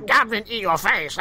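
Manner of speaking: high, cackling goblin voice; goblin puppet. Acted. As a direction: shriek like a puppet goblin on the attack. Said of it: "you just occasionally hear".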